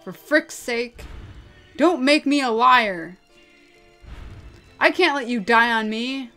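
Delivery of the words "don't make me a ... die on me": loud, forceful urging; desperate plea. Acted. Friend begging her not to die, desperate and loud. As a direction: refuse to let them slip away.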